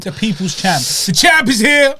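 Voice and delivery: high, loud call building to a drawn-out yell; hype-man shout. Pretend. Hyping the heavyweight champion's return, loud drawn-out shout. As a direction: herald the champion, slow and loud.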